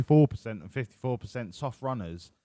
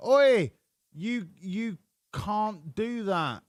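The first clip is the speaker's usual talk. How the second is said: mock Australian telling someone off, shouted Oi and slow rest; 8 semitones higher, much slower than usual. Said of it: "what he actually said was".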